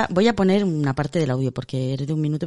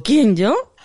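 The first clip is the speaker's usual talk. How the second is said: surprised laughing reply; 6 semitones higher, 6 dB louder than usual. Retold or imitated.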